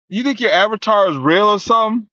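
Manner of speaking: höher und lauter, spöttisch; verhöhn ihren Avatar lautstark; taunting. Real, not acted. Taunting her about the avatar.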